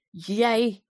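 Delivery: high, loud accusing cry; furious. A narrator's reading. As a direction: round on someone in a rage. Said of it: "riep de koning wit van drift". (driftig uitroepend)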